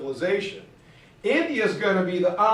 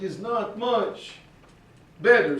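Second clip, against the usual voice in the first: put-on Russian accent. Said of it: "losing their Russian accent".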